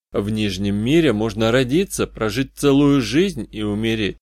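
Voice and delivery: usual speaking voice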